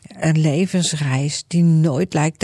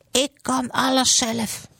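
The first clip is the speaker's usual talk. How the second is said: higher, put-on stubborn Grietje voice; Grietje calling out; 7 semitones higher than usual. Grietje shouting to everyone that she can manage. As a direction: proudly insist she can do it alone. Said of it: "Grietje roept het al jaren".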